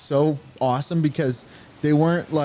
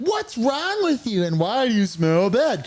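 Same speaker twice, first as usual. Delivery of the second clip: suspicious mom; 7 semitones higher, slower than usual. Retold or imitated. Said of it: "his mom's like".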